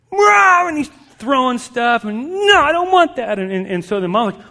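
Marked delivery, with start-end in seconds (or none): 0.1-0.7 loud, high imitation tantrum wail; tantrum roar. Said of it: "this kid goes off". 2.3-3.4 high, loud imitated child's protest; tantruming kid. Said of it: "this kid goes off".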